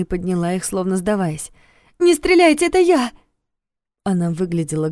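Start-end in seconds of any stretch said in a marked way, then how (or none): 2.0-3.1 high loud plea without tears. Acted. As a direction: shout for him not to shoot. Second Yulia pleads, not crying.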